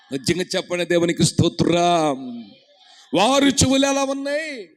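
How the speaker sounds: loud, high, fast preacher's call; preaching shout. Acted. Preacher urging the congregation to raise both hands, shouting.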